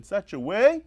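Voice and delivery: ordinary talk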